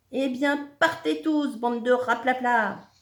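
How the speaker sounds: loud, high send-off; angry dismissal. A narrator's reading. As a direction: shout them away with contempt. Réplique furieuse aux génies.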